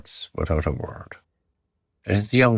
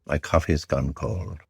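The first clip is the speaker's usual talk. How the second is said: ordinary delivery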